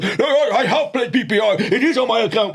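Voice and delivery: high-pitched mock protest; insistent angry caller. Caller insists to call centre about his claim, imitated indignant protest. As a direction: put on an indignant customer who won't be told. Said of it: "He goes".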